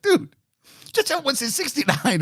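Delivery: a mock-baffled high question; incredulous. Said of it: "It's like".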